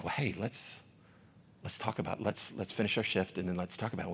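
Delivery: soft, low, comforting voice; gentle reassurance. Retold or imitated. To a weeping coworker before finishing the shift, gently reassuring. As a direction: offer help quietly and tenderly.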